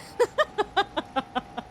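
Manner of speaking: high giggling burst of laughter; amused laughter. Real, not acted. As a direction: crack up at a chat joke. Cracks up at chat joke.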